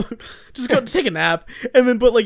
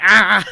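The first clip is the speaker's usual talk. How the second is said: loud imitated yelp; mock a pinned friend's short, loud cry; disgruntled cry; 7 dB louder than usual. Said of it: "he always makes those disgruntled voices".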